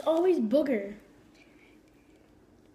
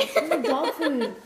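laughing. Real, not acted. Giggling over the bad bean.

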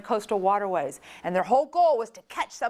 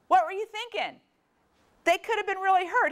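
much higher, outraged demand; alarmed scolding; 10 semitones higher than usual. Retold or imitated. Mother scolding her brother, high and outraged. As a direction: scold him hard. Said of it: "I call Sean and go".

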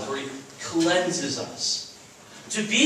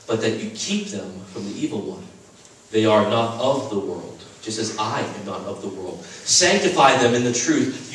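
measured, lower solemn Bible reading; scripture reading; 6 semitones lower than usual. Acted. Pastor reading Jesus' prayer to the chapel, solemn scripture reading. Reads John 17 aloud.

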